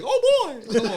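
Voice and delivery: high squeaky cartoon mouse; Mickey Mouse voice. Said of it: "Y'all over here sounding like".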